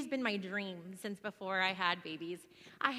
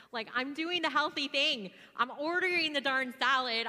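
louder, slowed, fed-up complaining; exasperated; 5 semitones higher, 8 dB louder than usual. Real, not acted. Telling the church about salad poisoning, exasperated and louder. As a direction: vent the frustration hard, every word pushed.

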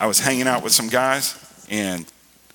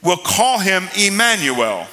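raised, declaiming preacher voice; preaching; 7 semitones higher, 3 dB louder than usual. Real, not acted. Preacher proclaiming Isaiah's prophecy to the congregation, declaiming. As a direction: proclaim it in a higher pitch.

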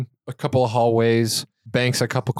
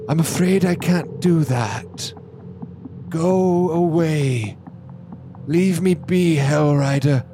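put-on old man refusing; 6 semitones higher, slower than usual.

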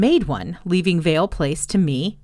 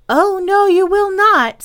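put-on high, loud know-it-all little girl; wisely; 13 semitones higher, 8 dB louder than usual. A narrator's reading. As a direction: correct the older boys knowingly. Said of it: "said Nanny wisely".

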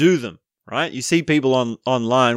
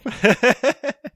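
high laughter; 13 semitones higher than usual. Real, not acted. Laughing at his own mockery.

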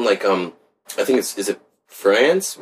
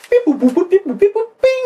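very high robotic beep-boops; high sing-song machine noises; imitated computer beeps; 4 dB louder than usual. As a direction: mimic a calculating computer with squeaky beeps. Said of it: "All right, computer".

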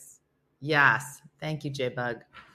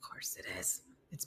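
much softer whispered sarcastic aside; 11 semitones lower, 18 dB quieter than usual. Real, not acted. Reacting to the heat in Bangkok, whispered sarcastic aside. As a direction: mutter it under your breath.

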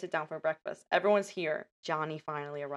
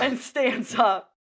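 slow, louder and higher, building drama; dramatic build-up; 6 semitones higher, 9 dB louder, much slower than usual. Retold or imitated. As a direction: stretch it out like a big reveal. Acting out dramatic standing.

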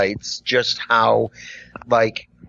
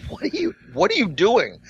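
much higher, stumbling disbelieving question; retold incredulous challenge; 13 semitones higher than usual. Barista confronts a customer behind the counter, baffled and incredulous. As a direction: pitch leaps up in mock bafflement.

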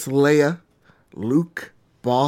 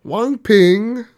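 mock Mandarin; 9 semitones higher, 7 dB louder than usual. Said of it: "Let's speak Mandarin".

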